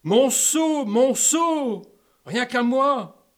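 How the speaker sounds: loud, high possessive cry. Acted. King claims his bucket, loud and possessive. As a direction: shout it out, hugging what is yours.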